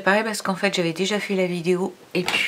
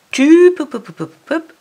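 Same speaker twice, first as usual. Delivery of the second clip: high playful "pop, pop" sounds, fading down; 10 semitones higher, 6 dB louder than usual. Shown as a demonstration. Teacher to child, popping out the silent letters playfully. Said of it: "playful sound for silent letters".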